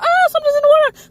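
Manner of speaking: high, loud panicked cry; panicked scream. Retold or imitated. Swimmers panicking after being grabbed, screaming. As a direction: scream in terror at the water. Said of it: "freaking out".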